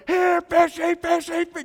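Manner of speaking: high, loud, strained fish call; frustrated Bert shrieking at the fish; Bert's furious call. Retold or imitated. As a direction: yell it out in total exasperation. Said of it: "he gets really mad ... Bert goes".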